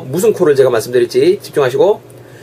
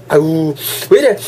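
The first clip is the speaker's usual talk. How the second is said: acting congested. Acting a person with a cold.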